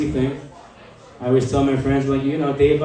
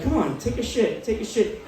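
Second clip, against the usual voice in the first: higher, softer pleading; coaxing the puppy; 4 semitones higher, 6 dB quieter than usual. Acted. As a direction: gently urge a dog along.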